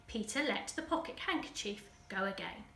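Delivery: plain reading voice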